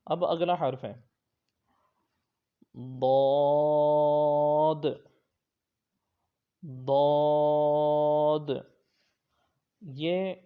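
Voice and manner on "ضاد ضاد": ضاد long, thick and loud from the tongue's side; heavy side-tongue daad. Shown as a demonstration. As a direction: draw out ضاد slowly, full. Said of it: "حرف حافیہ". (ضاد زبان کی کروٹ سے موٹا)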